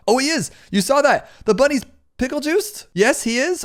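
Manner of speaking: high-pitched, thrilled reaction; excited discovery. Real, not acted. Gamer spotting the bunny got pickle juiced, excited. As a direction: burst out in delighted surprise. Delighted discovery during live game.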